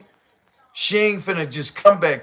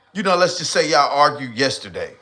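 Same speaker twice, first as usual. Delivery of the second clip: ordinary talk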